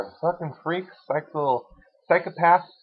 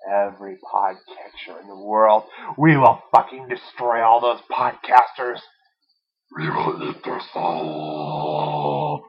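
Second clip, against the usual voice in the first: evil villain voice; 8 semitones lower, slower than usual. Acted. Villainous threat to podcasters.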